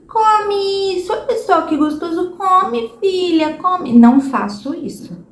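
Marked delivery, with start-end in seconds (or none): 0.1-4.0 put-on high, slow, sing-song coaxing of a child to eat; much higher and slower as if begging a toddler; pleading parent. Mother coaxing her daughter to eat, high sing-song pleading. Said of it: "Mas eu não fico assim".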